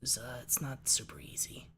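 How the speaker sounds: rough, breathy rasp; raspy voice. Shown as a demonstration. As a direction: rasp it out quietly. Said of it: "the raspy voice".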